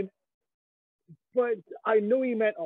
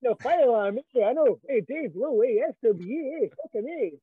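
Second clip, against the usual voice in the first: put-on loud, higher Fife accent shouting hey; 4 semitones higher, 5 dB louder than usual. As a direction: bellow venue names in a hammed-up Fife accent. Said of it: "in a Fife accent".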